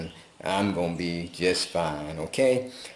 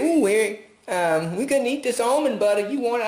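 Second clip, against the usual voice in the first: mock owners offering; 3 dB louder than usual. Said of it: "They said".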